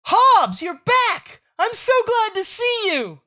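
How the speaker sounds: high, loud delighted cry; overjoyed. Acted. Child greeting his found tiger, high excited cry. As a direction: burst out with joy.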